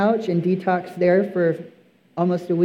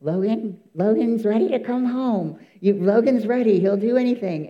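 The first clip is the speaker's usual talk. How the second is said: higher-pitched pleading on the phone; higher, urgent retold plea; insistent mother-in-law; 4 semitones higher than usual. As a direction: lightly imitate a mother-in-law who won't give up. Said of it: "She kept calling me".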